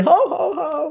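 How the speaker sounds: imitated ho-ho-ho, rising high; demonstrated belly laugh. Instructor demonstrates the Santa exercise, imitated belly laugh. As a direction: swoop up on mock Santa laughs. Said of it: "So she demonstrates".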